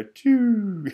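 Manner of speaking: high whooshing sound effect for money vanishing; mock whoosh. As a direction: imitate profit zooming away.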